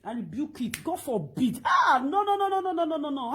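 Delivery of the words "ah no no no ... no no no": loud, high outburst falling away; real alarmed refusal. Real, not acted. Rebuking bad talk on her live video, real alarmed protest. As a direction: cry out a rapid string of refusals. Recoils from what was said.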